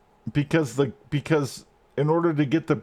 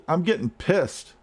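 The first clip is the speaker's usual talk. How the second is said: ordinary talk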